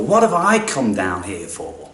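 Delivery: normal talking voice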